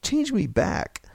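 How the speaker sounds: high, drawn-out desperate plea; imploring him; pleading. A narrator's reading. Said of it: "George pleaded".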